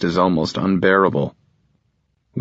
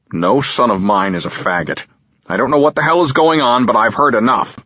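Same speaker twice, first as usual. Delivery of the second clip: furious father, higher and louder, fast; seething; 4 semitones higher, 4 dB louder than usual. Acted. Father raging at his son, seething. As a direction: rage spilling over. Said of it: "he seethes".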